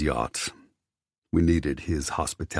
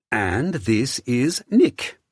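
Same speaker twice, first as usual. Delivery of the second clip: flamboyant Theo; 8 semitones higher, much slower than usual. Acted. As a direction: gush like a flamboyant host. Theo introduces his partner.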